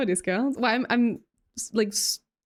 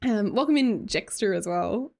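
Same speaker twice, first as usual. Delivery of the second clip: ordinary delivery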